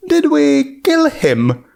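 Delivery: can't believe what just happened; alarmed question. A narrator's reading. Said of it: "Junebug shocked at corpse".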